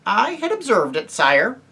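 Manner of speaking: high, shrill mouse voice; small courtly creature, high then dropping; Reepicheep. A narrator's reading. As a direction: answer stiffly in a high, piping voice. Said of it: "said Reepicheep".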